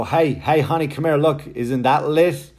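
loud, high mock-cool dad voice, slow at the end; dad playfully faking teen slang to his daughter; try-hard dad. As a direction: ham up an uncool dad trying to sound hip. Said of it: "I'll go".

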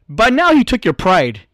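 ordinary talk, nothing marked

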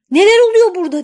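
high, loud outraged yell; angry shout. A narrator's reading. Girl finds spring and tree idle, shouting high and loud. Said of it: "diye bağırmış genç kız".

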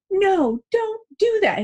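high mock child's yell, slowed down; mimicking toddler's yell. Mom imitates her three-year-old yelling mid-tantrum, high mock child yell. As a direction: pitch way up like a little kid screaming no. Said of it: "she would yell things like".

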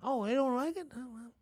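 a hint of an easygoing grandparent; imitating grandparent. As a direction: grandparent agreeing with grandkid against parents. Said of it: "that's how grandparents talk".

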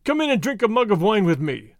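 usual speaking voice

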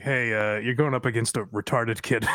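deep, matter-of-fact booker voice; low and deadpan, offhand. Retold or imitated. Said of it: "they're like".

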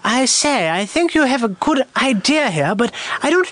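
high-pitched, proper upper-class English; the Crown English. Acted. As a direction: put on a proper King's English accent. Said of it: "that's the crown".

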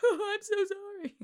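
squeaky high fake apology through laughter; high, laughing put-on innocence; mock sorry. To her partner after his revenge, hammed-up sorry. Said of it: "I'm like".

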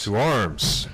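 brethren called out high and loud, slow; ceremonial command. Real, not acted. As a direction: call the toast like an officer. Toast response.

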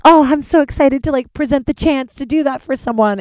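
high, loud bubbly eagerness; beaming with excitement; excited. Retold or imitated. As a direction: oversell your own enthusiasm. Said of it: "And so I'm like".

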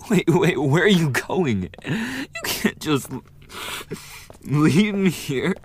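loud, high, sobbing call; crying plea. Acted. Rejected bully cries after the victim leaving. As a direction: beg them to stay through tears.

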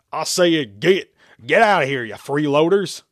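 high, loud farmer's holler; angry shout. A narrator's reading. Farmer orders freeloaders off his land, shouting. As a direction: holler at unwanted squatters. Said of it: "the farmer shouted again".

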